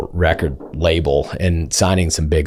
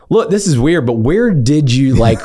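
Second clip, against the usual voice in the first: high, pointed disbelief; incredulous pressing; 9 semitones higher, 3 dB louder than usual. Retold or imitated. Lawyer cornering opposing counsel about his hometown, incredulous. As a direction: push him for a straight answer.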